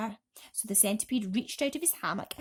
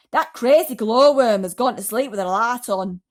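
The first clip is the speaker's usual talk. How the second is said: loud irritated snap; grumpy centipede; 13 dB louder than usual. A narrator's reading. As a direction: brush him off, annoyed. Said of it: "the centipede answered".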